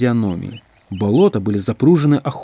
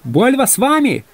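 high, carrying call; shouted question; 13 semitones higher, 3 dB louder than usual. A narrator's reading. Егор Егорыч asking the other troika, shouting. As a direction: call the question across to another carriage. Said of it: "крикнул Егор Егорыч".

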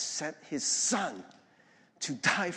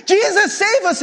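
very high, loud panicked plea; acted terrified yell for help; screaming and crying; 13 dB louder than usual. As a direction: scream for rescue, hammed up. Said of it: "they're screaming and they're crying".

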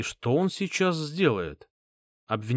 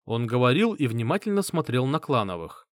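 usual speaking voice

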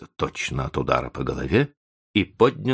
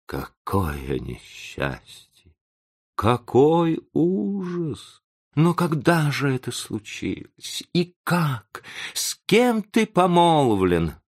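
moaning dismay; 10 semitones higher, slower than usual. A narrator's reading. Professor laments the engagement and asks who, moaning. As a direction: lament it, drawing the words out and rising. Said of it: "простонал он".

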